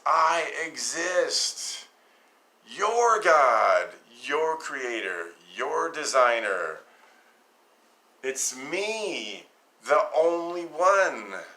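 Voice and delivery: "I exist" drawn out slowly and high; a grand, slow declaration; slow voice of God. Shown as a demonstration. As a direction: speak as God, slowly and with weight. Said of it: "It's not the Lord".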